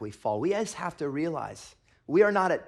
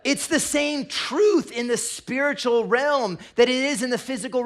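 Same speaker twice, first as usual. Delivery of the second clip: louder, higher preaching read; raised sermon reading; 9 semitones higher, 8 dB louder than usual. Acted. Pastor reading the verse to the church, raised and loud. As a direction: proclaim the verse over the room.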